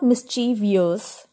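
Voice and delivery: "mischievous" as mis-CHEE-vee-us; wrong pronunciation. Shown as a demonstration. Teacher warning learners off mischievous as mis-CHEE-vee-us. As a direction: say "mischievous" with an extra "ee" before the ending. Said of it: "This is not".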